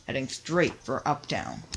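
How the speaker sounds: usual speaking voice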